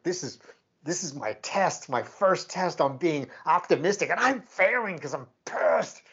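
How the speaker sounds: hammed-up furious venting; retold anger. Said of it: "I was so mad".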